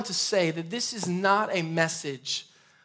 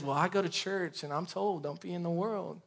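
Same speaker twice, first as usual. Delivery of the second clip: quiet mock churchgoer; 8 dB quieter than usual. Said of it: "we get caught up because".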